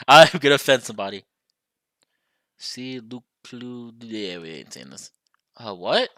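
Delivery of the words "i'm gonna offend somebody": louder, fast, giggling; quick laughing confession. Real, not acted. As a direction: laugh through the worry. Laughing at own line.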